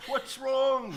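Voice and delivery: alarmed question. Acted. She seems flustered.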